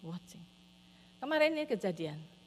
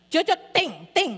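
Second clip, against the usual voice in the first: put-on loud little-kid parroting; punchy, repeated child-like exclamations; small child imitation; 6 semitones higher, 7 dB louder than usual. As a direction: mimic a toddler copying a scolding.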